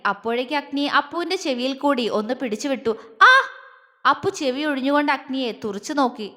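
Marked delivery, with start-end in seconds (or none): 3.2-3.3 short high yelp of pain. Acted. Appu gets his ear tweaked, sharp yelp. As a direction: cry out briefly as if pinched.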